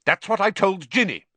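loud, high, cross retort; officious fierce reply. A narrator's reading. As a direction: fire it back sternly. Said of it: "said Percy fiercely".

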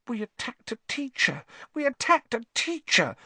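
high frightened whimper. Acted. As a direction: whimper it in panic. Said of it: "Hermione whimpered".

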